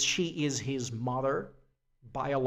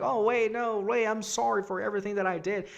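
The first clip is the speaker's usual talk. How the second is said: pleading apology; 7 semitones higher, faster than usual. Retold or imitated. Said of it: "she's like".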